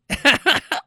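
high-pitched giggle; burst of laughter. Real, not acted. Telling how they flipped her off, high giggle. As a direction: laugh at your own story.

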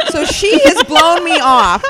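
high and slow, laughing; laughing aloud. Real, not acted. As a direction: laugh through the line. Laughing at own story.

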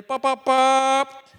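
slow loud horn beeps, bop bop bop; imitated car horn taps. Preacher imitating his horn at a slow driver, loud slow beeps. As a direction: tap the horn out with your voice, drawn out. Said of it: "I give her the little love tap".

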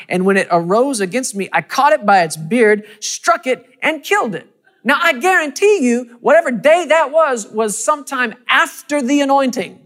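loud, high, driving preacher's cry; full-force preaching. Acted. Preacher thunders the story of David to the congregation, full force. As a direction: preach it out with rising fire.

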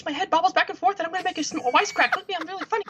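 very high-pitched mocking motormouth voice; mock a wisecracking actor in a squeaky high voice; Ryan Reynolds impression. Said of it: "talk really fast with this high-pitched voice".

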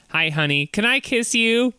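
ordinary talk

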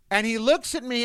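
ordinary talk, nothing marked

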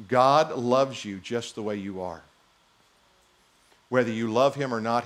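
usual speaking voice

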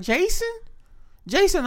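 put-on high old-lady call; grandmother calling. Grandmother calling her grandson by the wrong name. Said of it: "She be like".

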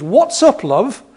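put-on light Manchester accent, high on "What's"; a friendly Manchester "love". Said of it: "in a wonderful Manchester accent".

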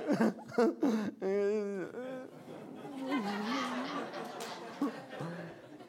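long embarrassed laugh at himself. Real, not acted. Pastor laughing at his own reaction, long laugh. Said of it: "I'm not like".

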